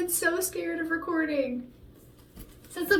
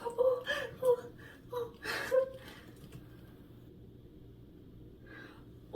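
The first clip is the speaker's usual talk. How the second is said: laughing and crying; 11 semitones higher, 7 dB quieter than usual. Real, not acted. Overwhelmed after good take.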